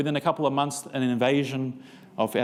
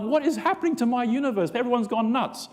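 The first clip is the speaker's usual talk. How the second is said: bewildered; 10 semitones higher than usual. Retold or imitated. Disbelief at atheist coworker.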